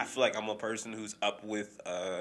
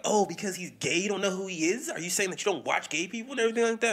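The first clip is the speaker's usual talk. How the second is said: high, fast indignant questioning; mock offended critic; 9 semitones higher, 4 dB louder, faster than usual. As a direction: rattle it off in a huffy voice.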